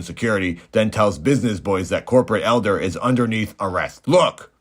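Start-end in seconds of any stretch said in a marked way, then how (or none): 4.1-4.5 higher, louder "Look!"; sharp exclamation. Acted. Robot cop confronts the business boys with evidence, sharp exclamation. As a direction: call it out like a cop springing proof.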